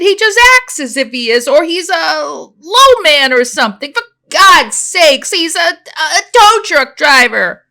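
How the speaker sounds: loud, high, heated protest, slowing at the end; angry rant. Acted. Jill protests to her friend, loud and high. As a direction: rant it out, slowing the last words.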